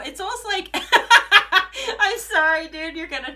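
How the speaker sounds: loud, high burst of laughter; apology lost in real giggles; laughing. Real, not acted. Reader apologising to Cancer viewers, laughing loudly.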